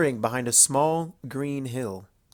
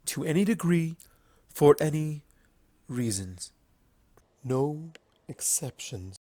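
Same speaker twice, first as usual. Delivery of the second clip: sorrowful confession. Acted. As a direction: admit it with regret. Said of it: "She spoke".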